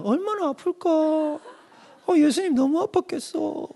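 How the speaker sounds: high, soft, slow pity for Jesus; mock pitiful believer. Preacher mocking a churchgoer's soft, high-pitched pity. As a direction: imitate a teary believer pitying Jesus, high and hushed. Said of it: "뭐라고 자꾸 성도들이 이해하냐면".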